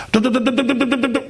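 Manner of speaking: mock hammering sounds; hammering noise. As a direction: imitate chisels breaking stone. Smashing the rock.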